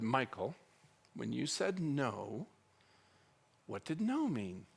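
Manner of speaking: slow, soft, measured question; very calm. Retold or imitated. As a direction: ask slowly and gently, keeping calm. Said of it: "I sit down next to him very calmly".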